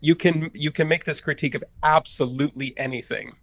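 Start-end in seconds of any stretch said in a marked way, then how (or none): none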